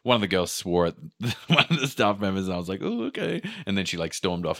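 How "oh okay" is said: high-pitched mock surprise. Reaction to girl swearing.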